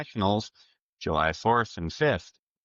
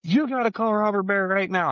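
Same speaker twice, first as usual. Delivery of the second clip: much higher, urgent imitated excitement; excited Dion; 13 semitones higher, 3 dB louder than usual. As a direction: pitch it up like a buddy bursting with news. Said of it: "Dion was absolutely plumb excited".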